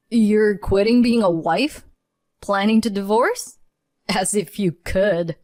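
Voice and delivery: husband scoffing at his wife's threat. Acted. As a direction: scoff in disbelief. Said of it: "he scoffed".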